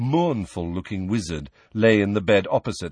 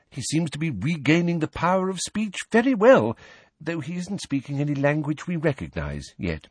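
higher-pitched, kindly fussing healer; chatty healer; 6 semitones higher than usual. A narrator's reading. Healer explaining her patient to his visitors, chatty. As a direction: play a chatty ward healer, voice lifted.

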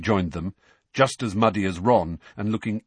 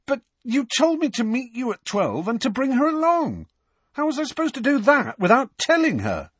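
high-pitched indignation; flustered, aggrieved outburst; spluttering protest. A narrator's reading. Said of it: "spluttered Harry".

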